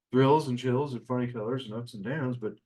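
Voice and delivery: ordinary talk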